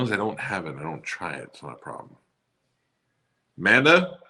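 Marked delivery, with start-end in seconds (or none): 3.6-4.2 loud call to another room. Real, not acted. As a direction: call her name across the house. Calling out to his wife.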